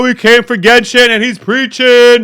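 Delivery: loud, whiny crowd complaint; heckling himself as an impatient fan; mock heckler. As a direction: complain like a bored viewer.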